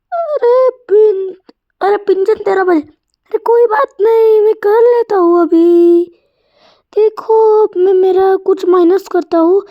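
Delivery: put-on high child voice. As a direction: play a chirpy little boy. High kid voice. (ऊँची आवाज़ में शरारती बच्चा)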